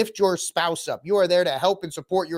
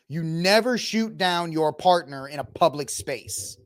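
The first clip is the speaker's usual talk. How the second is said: ordinary delivery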